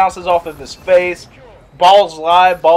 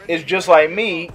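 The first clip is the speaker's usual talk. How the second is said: ordinary talk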